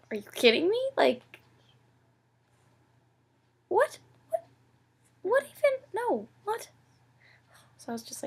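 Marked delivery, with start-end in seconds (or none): 0.1-6.7 high, loud, mock disbelief; incredulous disbelief. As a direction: replay the moment you couldn't believe it, pitch shooting up. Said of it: "but I was just like".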